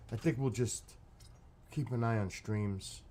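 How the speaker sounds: ordinary talk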